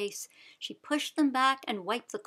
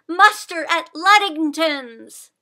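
slow, louder, higher call; shouting; 6 semitones higher, 11 dB louder, slower than usual. A narrator's reading. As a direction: rouse the villagers. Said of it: "Sybil cried".

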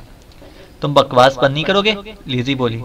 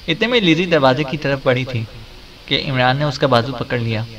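plain reading voice

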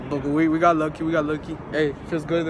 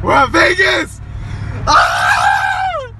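much higher, louder thrilled yell; excited shout; 10 semitones higher, 8 dB louder, much slower than usual. Real, not acted. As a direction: shout it out, overjoyed. Arriving in Vegas, yelling.

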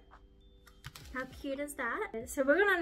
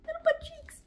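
cute squeal; 14 semitones higher, 4 dB louder than usual. Real, not acted. Squealing over tiny decorations.

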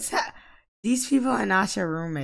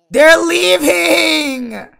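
slow, high, loud cry; distressed wail; 11 semitones higher, 13 dB louder, much slower than usual. Real, not acted. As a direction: wail as guests walk out. Guests leaving the wedding.

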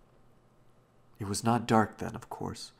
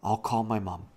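slightly urgent, louder offer; said quickly; 5 dB louder than usual. A narrator's reading. Robbie alarmed at old Da's state, speaking quickly. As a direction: blurt it out, a touch alarmed. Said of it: "and so he said quickly".

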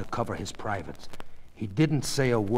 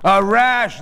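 defensive; 10 semitones higher, 10 dB louder than usual. Acted. Husband defensive in quarrel.

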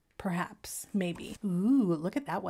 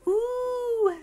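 excited gasp and ooh; 13 semitones higher than usual. Real, not acted. Gasps at new fabric.

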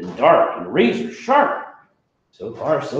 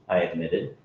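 usual speaking voice

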